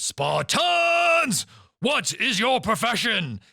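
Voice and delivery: booming high battle shout to the troops; rallying war cry. Retold or imitated. Spartan leader shouting to his own men, booming battle cry. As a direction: roar it out like a Spartan king.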